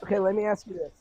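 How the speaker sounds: ordinary talk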